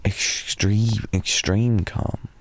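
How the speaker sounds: ordinary delivery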